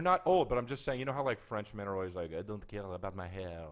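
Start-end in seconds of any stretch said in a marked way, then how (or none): 2.3-3.6 put-on low, slow, soft French accent; bad French accent. Guest imitates carefree Frenchmen, bad French accent. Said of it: "That's your French accent?".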